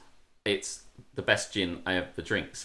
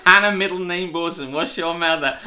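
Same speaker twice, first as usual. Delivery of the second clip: ordinary talk, nothing marked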